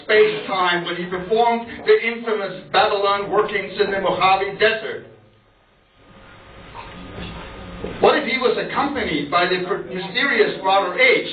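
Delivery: rhetorical spoken-word poetry reading; performed poem. Acted. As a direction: fire off insistent questions to an audience.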